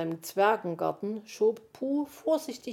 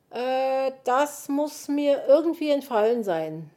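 higher, hesitant admission; sheepish; 7 semitones higher, 6 dB louder than usual. A narrator's reading. Willi admits he forgot the solvent, sheepish. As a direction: admit it sheepishly after a long "Äh". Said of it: "sagte Willi kleinlaut".

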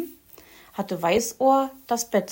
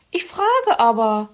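insistent Frau Elster; 3 semitones higher, 6 dB louder than usual. A narrator's reading. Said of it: "blieb sie beharrlich".

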